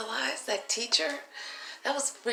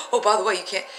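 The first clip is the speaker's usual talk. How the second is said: normal talking voice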